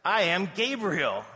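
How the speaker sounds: slow, loud, stately proclamation; solemn declaration, each word given weight; angel Gabriel. Acted. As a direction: announce yourself with measured grandeur. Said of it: "the angel answered and said".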